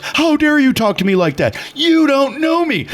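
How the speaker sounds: high, affronted mock tirade; retold ballistic outburst. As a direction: imitate an outraged man, pitch climbing. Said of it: "Mister Scambray's just going ballistic".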